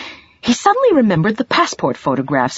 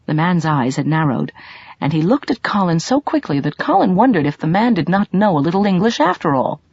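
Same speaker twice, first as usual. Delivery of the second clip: plain reading voice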